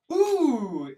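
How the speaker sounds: loud wincing ooh from the crowd; pained crowd ooh. Retold or imitated. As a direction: wince out loud like a sympathetic crowd. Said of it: "half the crowd is like".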